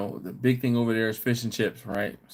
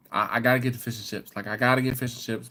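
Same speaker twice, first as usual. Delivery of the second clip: ordinary talk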